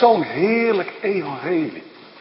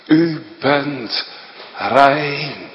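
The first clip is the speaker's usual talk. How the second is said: ordinary talk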